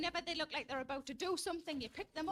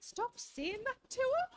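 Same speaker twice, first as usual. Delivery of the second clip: slow, higher, louder telling-off; exasperated outburst; 9 semitones higher, 6 dB louder, much slower than usual. Retold or imitated. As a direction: snap at him to stop. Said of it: "I said".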